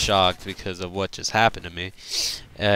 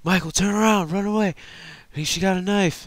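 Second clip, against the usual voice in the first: high, panicked warning; brother's frantic high warning cry; urgent warning yell; 11 semitones higher than usual. Retold or imitated. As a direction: shout to save a friend from a knife. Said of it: "her brother yells at my name".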